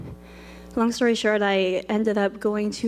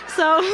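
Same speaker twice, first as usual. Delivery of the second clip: high teary laughter; laugh-cry; 10 semitones higher than usual. Real, not acted. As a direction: laugh through tears. Laughing while crying.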